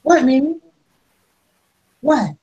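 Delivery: high-pitched, short questioning calls; high, impatient pet-talk; calling to cat. Real, not acted. Answering a meowing cat.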